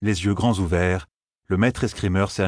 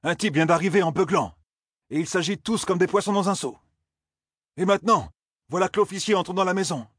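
higher, urgent lookout report; Aurine, higher voice; 11 semitones higher than usual. A narrator's reading. Aurine to the group, higher and urgent. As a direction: hurry the news out.

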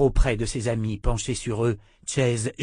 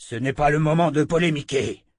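loud, higher-pitched scolding; urgent shout; 5 semitones higher, 5 dB louder than usual. A narrator's reading. Chase cutting short the woman's objections, shouting. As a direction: shout down the argument. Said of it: "cria Chase".

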